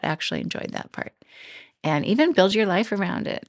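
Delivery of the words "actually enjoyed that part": "Actually enjoyed that part" in a soft whisper; whispered aside. Pretend. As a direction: tell it to yourself in a private whisper. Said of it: "Start with that".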